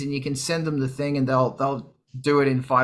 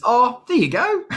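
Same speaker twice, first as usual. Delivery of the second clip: put-on very broad Australian accent, high and loud; hammed-up Aussie impression, rising at the end; 11 semitones higher, 6 dB louder than usual. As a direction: exaggerate the Australian accent, pitched way up. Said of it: "in a very Australian Al accent".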